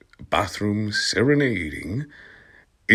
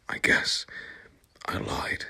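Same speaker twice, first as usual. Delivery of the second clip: whispered; 8 dB quieter than usual. Acted. Sad reply in comic reading.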